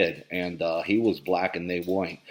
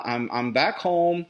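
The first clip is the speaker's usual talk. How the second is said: normal talking voice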